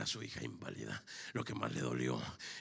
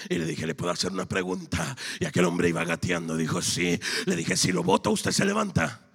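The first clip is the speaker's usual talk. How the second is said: high, loud shouted testimony; full-force preaching; 12 semitones higher, 12 dB louder than usual. Acted. Preacher retelling a crawling pilgrim's faith to the congregation, full-force shouted preaching. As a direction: build to a roaring cry at the end.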